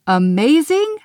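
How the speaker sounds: high, loud disbelieving Amazing? rising at the end; incredulous. Acted. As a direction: throw the word back in disbelief. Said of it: "Glitter's eyes popped".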